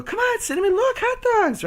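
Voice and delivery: very high, sweet sing-song lure; high coaxing pet voice; luring pet talk. Retold or imitated. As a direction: tempt the dog with a treat. Luring dog.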